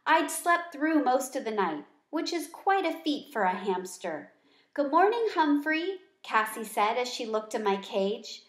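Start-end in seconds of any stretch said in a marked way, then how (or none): 4.8-6.0 higher, cheerful good morning; bright greeting. Acted.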